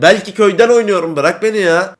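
high, irritated outburst. Real, not acted. Gamer arguing with the internet warning, irritated. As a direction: tell off a nagging game message.

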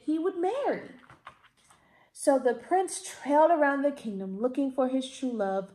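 usual speaking voice

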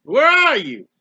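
loud cry out to an absent God. Acted. Preacher voicing a sufferer calling on God, loud plea. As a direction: call out loudly, pleading for an answer.

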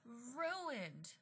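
high but hushed wail; anguished cry. Acted. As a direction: lament it softly in despair. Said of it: "Mister Morley cried".

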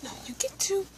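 whispered aside, no voice. Real, not acted. Telling the kid his order at the drive-through, whispering.

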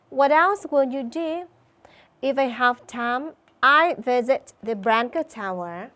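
slow, clear dialogue read for pupils; model dialogue. Shown as a demonstration. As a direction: read both parts slowly and clearly. Teacher models new dialogue.

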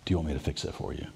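low, soft, quick offer; God speaking. Acted. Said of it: "and when God says".

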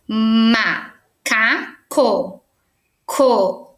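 "macaco, coelho" broken into slow separate syllables; teacher sounding out "macaco, coelho" in syllables. Shown as a demonstration. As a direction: pause after each syllable of "macaco" and "coelho". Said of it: "separando as sílabas".

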